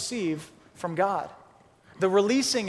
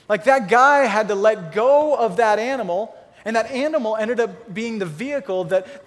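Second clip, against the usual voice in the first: usual speaking voice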